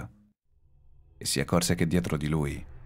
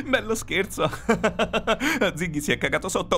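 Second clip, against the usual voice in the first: high, panicky shouting broken by laughter; hysterical laughing. A narrator's reading. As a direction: laugh wildly at the supposed joke, pitch sky-high. Panicked man laughs at supposed prank. (risata isterica tra le grida)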